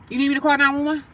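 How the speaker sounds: high, pointed question; retold urgent demand.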